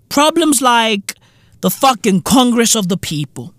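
ordinary talk, nothing marked

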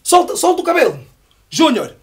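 put-on high, loud call to a kid; shouting. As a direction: cheer the boy on loudly. Retelling uncle calling to boy.

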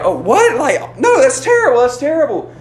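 high, mock-upset little kid; fake seven-year-old. Fake seven-year-old answering a prank text, jokingly upset. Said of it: "he responds just kinda jokingly".

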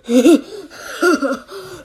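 bursting out laughing; laughing at own joke. Real, not acted. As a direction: crack up at your own punchline.

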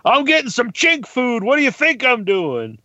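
high-pitched, blustering retort; Kenny. Retold or imitated. Podcaster mimicking his neighbor answering in the restaurant, brash and indignant. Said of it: "And he just goes".

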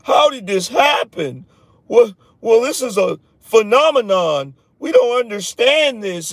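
a hammed-up, puzzled expert whining on TV; mock whiny pundit. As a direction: mimic the baffled Bloomberg pundits, whining. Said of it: "I like a bunch of whining".

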